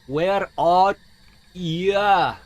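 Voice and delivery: "Where are ya?" drawn out, loud, with too much weight on "ya"; over-exaggerated "ya". Shown as a demonstration. Calling to a hidden ghost, over-exaggerated drawn-out "ya". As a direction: stretch "Where are" slowly, then lean hard on "ya". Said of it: "it's, like, too much "ya" at the end".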